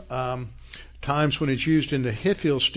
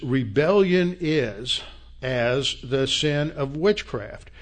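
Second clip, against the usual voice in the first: slow scripture reading; slower than usual. Shown as a demonstration. Preacher reads the verse aloud to congregation, slow and solemn. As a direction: read each word out slowly and weightily. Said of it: "let me read it again".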